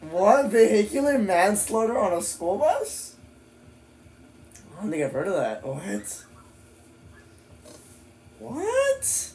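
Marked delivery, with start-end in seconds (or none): 0.0-3.1 high-pitched, astonished questioning; stunned question, pitched up to "bus"; incredulous. Real, not acted. Repeats the headline in disbelief. 5.6-9.3 rising, ever higher questioning squeak; high, softer astonished question; baffled disbelief. Real, not acted. Still stunned by the headline.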